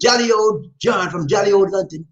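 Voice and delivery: put-on playful stab at an English accent; jokey, half-there English accent; attempted English accent. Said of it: "my attempt at an English accent".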